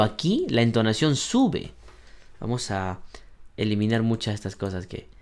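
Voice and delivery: usual speaking voice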